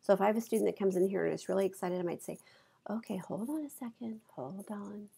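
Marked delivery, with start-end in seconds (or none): 2.9-5.1 hushed, near-whispered de-escalation; softer, calming voice. Shown as a demonstration. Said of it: "I'm gonna use my voice".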